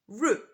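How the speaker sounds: route rhyming with boot, root; British route. Shown as a demonstration. Teacher models British route. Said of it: "in British English, we say".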